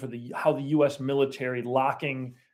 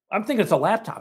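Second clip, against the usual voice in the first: ordinary talk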